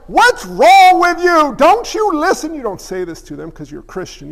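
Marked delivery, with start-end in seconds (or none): 0.1-2.5 much higher and louder, slow frustrated yell; exasperated outburst. Retold or imitated. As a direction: blow up at the person you mentor. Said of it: "And you're like".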